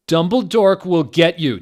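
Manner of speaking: hammed-up defiant shout. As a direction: yell it defiantly. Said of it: "Draco shooted".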